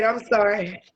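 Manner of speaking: normal talking voice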